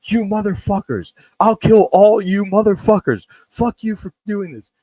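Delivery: high, loud enraged shouting at the dark; a neighbor's unhinged rage, roared high; furious screaming. Retold or imitated. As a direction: scream it at the night like a madman. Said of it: "just starts screaming".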